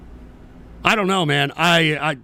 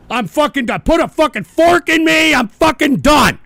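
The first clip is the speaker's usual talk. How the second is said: fed up outburst; 5 semitones higher, 4 dB louder than usual. Real, not acted.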